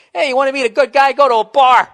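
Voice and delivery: loud mock advice, fast; mock shout. Mock yelling advice at a woman. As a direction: bark it at her like it's obvious. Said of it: "That's like me saying to a woman".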